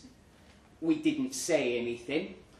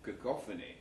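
lower, softer drawling band name; 6 semitones lower, 10 dB quieter, faster than usual. Retold or imitated. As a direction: drawl it low and cool. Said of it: "in that low drawl of his".

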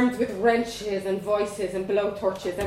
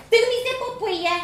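put-on high, bold Dublin girl voice; young Dublin girl; 13 semitones higher, 8 dB louder than usual. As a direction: play a cheeky little Dublin girl. Vanessa asks for zip.

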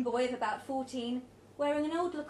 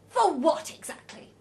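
loud, higher question; raised, indignant voice; challenging retort; 5 semitones higher, 9 dB louder than usual. A narrator's reading. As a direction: throw the question back loudly. Roger challenges Elsa's suggestion.

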